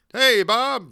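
high, loud, stiff hello; awkward host greeting. Retold or imitated.

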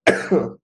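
short choking cough; coughing up water. Real, not acted. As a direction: clear your throat with a hard cough. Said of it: "water went down the wrong pipe".

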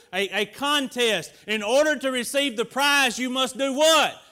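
loud, driving sermon cadence; preaching at full force. Acted. Pastor urging the church to stay focused, preaching hard. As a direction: press the congregation to stay in the fight.

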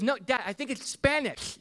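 put-on high little-girl voice; Evie. Daughter contradicting dad about the cartoon, high childlike insistence. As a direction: imitate a small daughter insisting. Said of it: "She goes".

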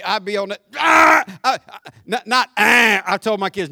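normal talking voice

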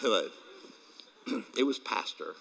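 normal talking voice

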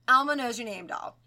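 usual speaking voice

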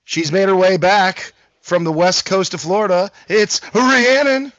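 drawn-out host announcement; announcer intro. Acted. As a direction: announce the guest like a game show.

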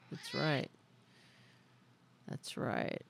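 whispered, breathy echo. Pretend. Repeating it to the chat as a fading whisper. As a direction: breathe the words out like a soft echo. Echoing the same phrase, fading out.

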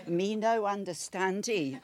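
broken English, laughing through it; laughing mock pidgin; mock foreigner talk. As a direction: put on a pidgin accent as a joke. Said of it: "you put on an accent then".

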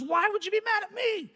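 high-pitched mock bewilderment; imitated baffled friend; wounded protest. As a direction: protest innocence, voice jumping up. Friend protests at being blamed.

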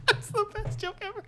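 high, squeaky laughing words; laughing hard. Real, not acted. Joke teller cracks up at own whale joke, laughing. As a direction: squeeze the words out through real laughter.

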